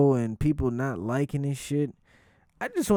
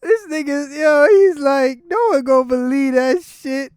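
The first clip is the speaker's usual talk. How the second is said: high, loud voice cracking up; real laughter overtaking the retold joke; rolling with laughter; 13 semitones higher, 8 dB louder than usual. Real, not acted. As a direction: squeal it out, barely holding it together. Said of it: "That shit had me rolling".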